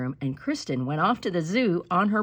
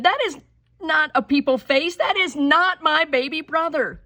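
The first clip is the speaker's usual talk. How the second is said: high, louder protest; indignant child; 10 semitones higher, 6 dB louder than usual. Acted. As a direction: refuse the seal baby. Kristen protests the baby.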